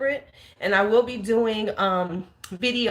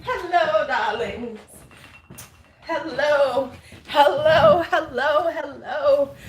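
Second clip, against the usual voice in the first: breathy vintage purr; 5 semitones higher, 3 dB louder, slower than usual. Acted. Sultry greeting to live chat.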